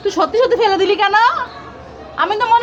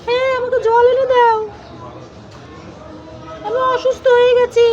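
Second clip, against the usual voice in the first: higher, pleading voice of a sick tree; 6 semitones higher than usual. Pretend. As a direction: beg the child for water as the tree. (অসুস্থ গাছের উঁচু গলায় জল চাওয়া)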